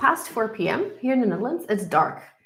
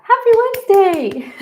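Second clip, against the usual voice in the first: much higher, louder sing-song greeting; excited; 11 semitones higher, 8 dB louder than usual. Shown as a demonstration. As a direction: belt it out with joy.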